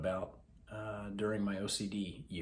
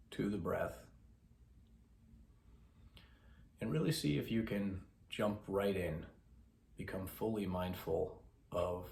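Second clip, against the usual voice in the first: soft, measured voice with long pauses; unhurried meditation guide, softer than chatting; guided meditation; 6 dB quieter than usual. Acted. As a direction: guide listeners gently into breath awareness.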